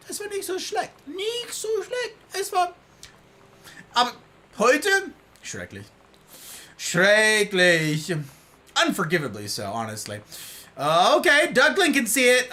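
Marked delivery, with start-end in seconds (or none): 0.1-8.1 high, playful German; sing-song it in German; German phrases. Real, not acted. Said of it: "nice accent".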